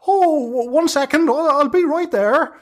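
put-on high squeaky old hag calling down from the trees; high-pitched busy hag.

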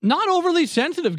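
defensive. Real, not acted. Defensive reply to teasing.

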